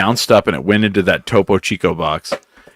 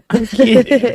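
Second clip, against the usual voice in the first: laughing through the words; slower than usual. Real, not acted. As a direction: giggle it out. Laughing off a joke.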